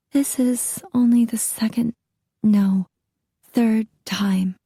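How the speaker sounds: lightly voiced scared young wife; timid young wife. A narrator's reading. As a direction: admit it reluctantly, correcting herself. Battered wife admitting abuse.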